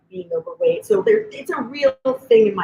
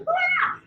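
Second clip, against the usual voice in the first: high mock anxious ah; a cartoon panic yelp; panicked shriek; 4 dB quieter than usual. Anxiety character from Inside Out.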